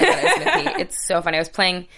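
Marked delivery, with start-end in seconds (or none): 0.0-0.8 laughing. Real, not acted. Laughing about the game.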